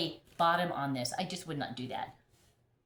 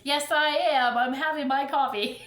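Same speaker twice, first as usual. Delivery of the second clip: laughing, louder, higher call back; cheerful reply; 6 semitones higher, 6 dB louder than usual. Retold or imitated. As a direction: shout back with a proud laugh.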